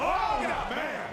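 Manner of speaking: sudden excited cry, pitched high. Real, not acted. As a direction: burst out with excitement. Big move in live match.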